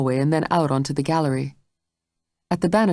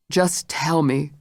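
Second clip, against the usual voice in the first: strained; slower than usual. A narrator's reading. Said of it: "her voice thin with strain".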